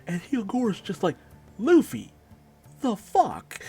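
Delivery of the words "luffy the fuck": high, loud mock disbelief; stunned disbelief. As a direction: act out an appalled friend calling him out. Retold friend stunned by Luffy's confession.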